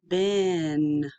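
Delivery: BEN drawn out slowly on a level pitch; stretched out. Shown as a demonstration. Teacher blending sounds for students, BEN slowly stretched out. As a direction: stretch BEN, holding vowel and n. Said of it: "say it slowly and stretched out".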